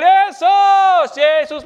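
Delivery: loud calling Yesus, Yesus; frantic parent calling a name. Acted. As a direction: call out for a lost child across a crowd. Parents calling for lost child.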